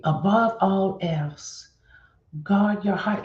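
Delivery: above all else, drawn out slowly; slow emphatic reading. Shown as a demonstration. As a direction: savor each word of the verse. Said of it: "Did y'all hear that?".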